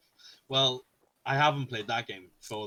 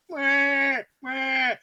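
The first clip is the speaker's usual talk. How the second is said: high, loud mock infant wail; imitated baby cry; 12 semitones higher, 6 dB louder than usual. Father imitating his baby woken by the headset, mock wailing. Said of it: "the baby just woke up and was like".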